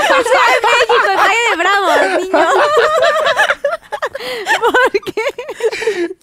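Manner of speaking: high-pitched, laughing through the words; laughing protest. Real, not acted. Bride teased about her wedding budget, laughing protest.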